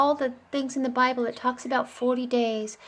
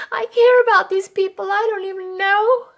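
much higher and louder, impassioned; passionate cry; 9 semitones higher, 10 dB louder than usual. Real, not acted. Preacher contrasting herself with Jonah, impassioned loud declaration. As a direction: pour out heartfelt care loudly.

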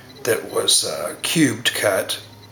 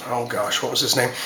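ordinary talk

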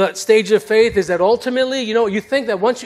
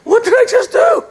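panicked self-doubt; 13 semitones higher than usual. Retold or imitated. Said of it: "I'm sitting here going".

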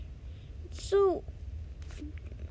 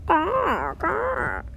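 sea creature growl; 3 semitones higher than usual. Acted. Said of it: "Your languages even sound dis-, di-, different".

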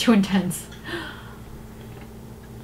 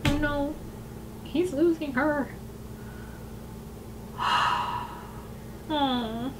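much softer and higher, sorrowful; saddened; 4 semitones higher, 13 dB quieter, slower than usual. Real, not acted. As he loses her in the video, soft and sad. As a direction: murmur it, heartbroken for them.

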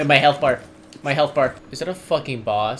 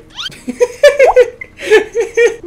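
loud high laugh; 12 dB louder than usual. Real, not acted. Laughing at being followed.

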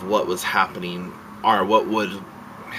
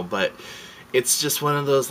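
ordinary delivery

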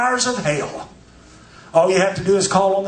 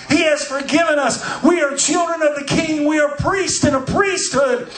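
raised, ringing preacher's call; preaching at full force; 4 semitones higher than usual. Acted.

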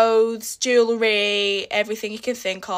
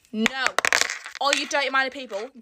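ordinary talk, nothing marked

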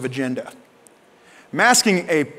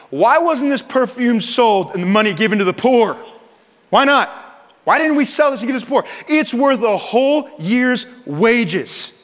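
high, loud, outraged protest; indignant objection; 7 semitones higher, 4 dB louder than usual. Acted. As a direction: bristle with mock outrage at the waste.

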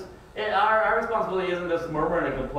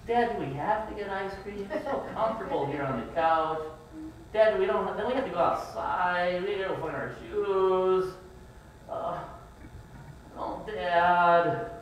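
put-on whiny kids; 5 semitones higher than usual. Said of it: "Which one do you think is more motivating".

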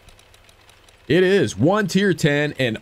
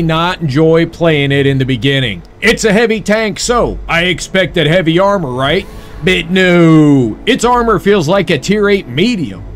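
loud, steady review narration; voiceover read; 4 semitones higher, 7 dB louder than usual. Acted. Scripted tank review voiceover.

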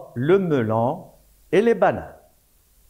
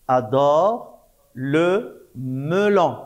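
slow, drawn-out reading of the words; slow syllable reading; slower than usual. Shown as a demonstration. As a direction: stretch each syllable for the pupils. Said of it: "on entend O". (lecture lente, syllabe par syllabe)

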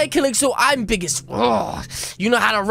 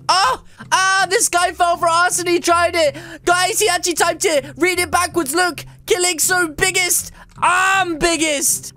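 delighted, pitched-up triumph; gleeful gloating; 5 semitones higher than usual. Real, not acted. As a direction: crow over a prank that worked. Gloats over successful prank.

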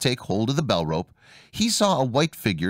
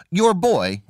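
high, louder accusing cry; upset wife crying out; cried out; 7 semitones higher, 4 dB louder than usual. Acted. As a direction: burst in on the father with it. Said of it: "cried she".

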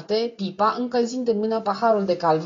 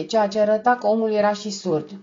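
ordinary delivery, nothing marked

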